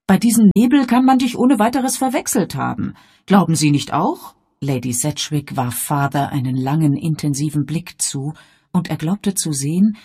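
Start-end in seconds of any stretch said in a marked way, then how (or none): 0.1-4.4 insistent mother. Acted.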